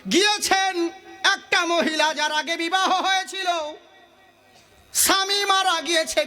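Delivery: high, intoned sermon narration; melodic preaching. Acted. Preacher telling of the widow's house, high chant. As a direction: chant the tale out to the gathering.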